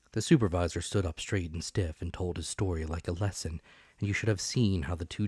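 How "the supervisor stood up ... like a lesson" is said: ordinary delivery, nothing marked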